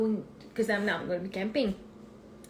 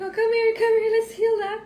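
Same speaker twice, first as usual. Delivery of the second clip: put-on higher, soothing call to the child; comforting; 12 semitones higher, 7 dB louder than usual. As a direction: coax her over tenderly. Mother comforting hurt daughter.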